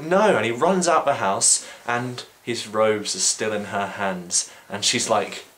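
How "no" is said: high, loud yelp of refusal; mock panicked no. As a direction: shriek it as he escapes. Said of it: "and he's like".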